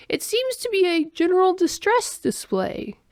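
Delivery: high-pitched formal alien; alien explaining. A narrator's reading. Alien diagnoses friend's distress display, explaining. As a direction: report it in a lifted alien voice.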